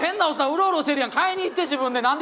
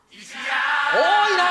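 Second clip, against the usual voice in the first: surprised shout; 2 semitones higher, much slower than usual. Real, not acted. Shocked at crowded truck.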